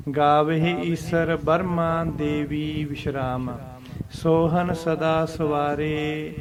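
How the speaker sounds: slow recitation with a pause after Devi; slow chant, one break. Shown as a demonstration. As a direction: chant slowly, rest on Devi then go on. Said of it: "ਇਸ ਤਰ੍ਹਾਂ".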